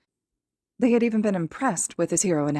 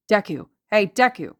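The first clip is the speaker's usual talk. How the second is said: sharp, raised-voice call; 3 semitones higher, 6 dB louder than usual. A narrator's reading. Bully hailing Izuku on the street, calling out. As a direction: call out to get his attention. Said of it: "someone had called".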